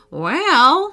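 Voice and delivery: high, rising, drawn-out thought; hopeful musing. A narrator's reading. Grandpa considers a new lottery ticket, hopeful musing. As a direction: brighten on the word, lifting it up.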